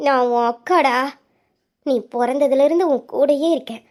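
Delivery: ordinary talk, nothing marked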